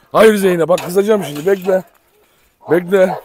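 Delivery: loud stern warning; warning the dog. Real, not acted. Owner warning his dog Zeynep, loudly stern.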